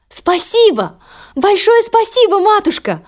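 high, bright joyful thanks; excited exclaim. Acted. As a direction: burst out with joy. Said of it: "воскликнула Золушка".

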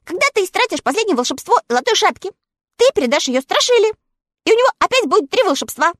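high, quick small dog; little dog voice. A narrator's reading. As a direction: chatter like an eager little dog. Totoshka explains.

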